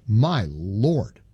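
normal talking voice

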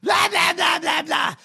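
mock her boring speech; mocking blah blah. Mocking a dull Democratic speech.

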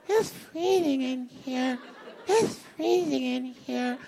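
nagging whiny repeat; whiny woman voice. Retold or imitated. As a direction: whine it over and over. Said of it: "You know why I do that voice?".

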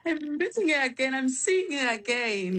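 real excitement, stretching the words with a laugh; excited greeting. Real, not acted. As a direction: greet a dear friend excitedly. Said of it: "looking at you excitedly".

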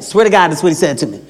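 normal talking voice

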